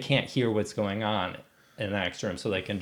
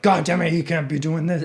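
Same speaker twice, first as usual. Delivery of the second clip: mild mock outrage, higher pitch; exasperated police chief; 5 semitones higher than usual. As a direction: lightly play the fed-up chief.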